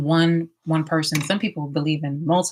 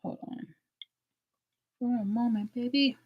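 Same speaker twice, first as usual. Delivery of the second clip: hoarse, choked words; coughing up water; 7 semitones higher, 7 dB quieter than usual. Real, not acted. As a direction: still choking on water. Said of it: "This went down the wrong pipe".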